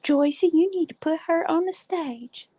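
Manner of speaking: grandma voice. Retold or imitated. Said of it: "my grandma would go".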